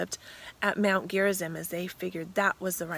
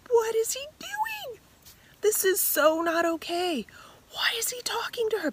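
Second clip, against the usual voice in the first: much higher, indignant disbelief; shocked disciples. Acted. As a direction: disciples thinking in shock at Jesus and the woman, high incredulous voice. Disciples' shocked inner thoughts.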